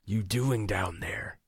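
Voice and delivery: slow, slurred, half-conscious voice; slurred speech. A narrator's reading. Dangling wounded man slurs at the one robbing him, slowly. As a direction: drag the words out like you're barely awake. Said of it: "speech slurred".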